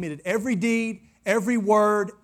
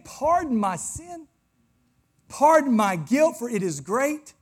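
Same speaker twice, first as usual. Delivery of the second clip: pleading preaching; 6 semitones higher, 3 dB louder, slower than usual. Acted. Preacher voicing David's plea.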